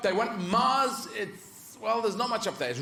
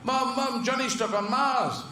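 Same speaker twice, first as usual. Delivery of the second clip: put-on high-pitched urgent kid; child calling Mum; 8 semitones higher than usual. Child tells Mum of a friend's mishap, high urgent call.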